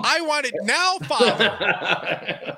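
put-on whiny, demanding spoiled child; high, bratty demand to her father; Veruca Salt. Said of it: "Not the Veruca Salt".

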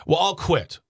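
sarcastic mock quote. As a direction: toss it off like a smug quitter. Mocking the teacher's easy way out.

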